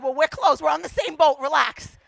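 loud, comic exasperation; exasperated reassurance. Retold or imitated. Pastor reassuring anxious woman on same boat, loudly exasperated. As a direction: tell her firmly to calm down.

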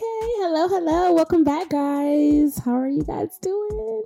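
high, loud and bright hello to the listeners; loud, high welcome to the show; excited greeting. Real, not acted. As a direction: greet the audience with energy. Podcast host greeting listeners.

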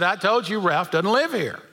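a touch of annoyance, kept in the normal voice; mildly irritated. Retold or imitated. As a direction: lightly put out, only a little impatient. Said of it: "got a little more irritated".

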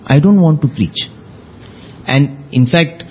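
normal talking voice

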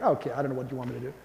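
low, soft, rushed brush-off; unimpressed listener. Acted. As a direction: toss it off quickly under your voice. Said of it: "You're like".

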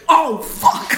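sudden high yelp of shock; jolted by a splash; startled curse. Real, not acted. Drink spat on her mid-story.

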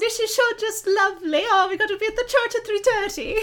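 put-on high, loud British accent with laughter breaking through; hammed-up English lady, giggling; clumsy fake British accent, half laughing; bad British accent. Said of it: "I can't do any of these accents".

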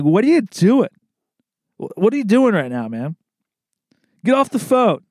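hammed-up disbelief, voice pushed up; mock exasperation. Sales rep griping at chatty coworkers, mock exasperated.

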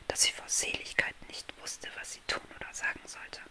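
soft unvoiced bedtime-story whisper; hushed fairy-tale narration; whispered storytelling. A narrator's reading. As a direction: whisper the tale close to the listener.

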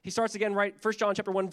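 normal talking voice

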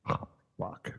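breathy whisper, caught out; whispered oh fuck. Retold or imitated. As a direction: whisper it under your breath, dismayed. Caught out, muttered aside.

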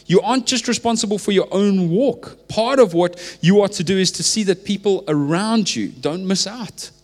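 high, loud, urgent exhortation; impassioned preacher building to a peak; preaching at full force. Acted. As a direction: rise up and press it on the room.

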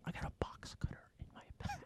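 hushed menacing whisper; whispered threat. Retold or imitated.

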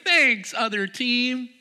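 high, drawn-out sarcastic thank-you; a mocking, high and slow imitation of the thanks. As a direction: send up the post-game thanks, sarcastic. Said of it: "I don't know why they do that".

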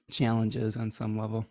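ordinary delivery

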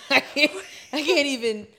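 laughing through the words; can't stop laughing. Real, not acted. Laughing fit after joke.

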